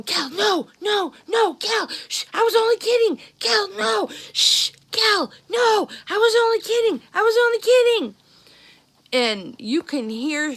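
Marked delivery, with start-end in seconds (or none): 0.0-8.1 high, frantic hushing, begging her to stop; a scared big brother trying to shush her; panicked pleading. Retold or imitated. Big brother begs his crying sister to hush, panicked high voice. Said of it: "there's my brother going".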